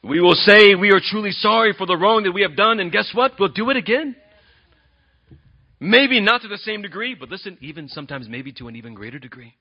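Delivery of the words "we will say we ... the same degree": forceful preaching. Real, not acted. Preacher presses the point to congregation.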